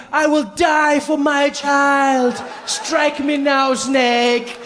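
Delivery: slow, high, heroic declaration; heroic mother. Retold or imitated. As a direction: declare it slowly like a martyr.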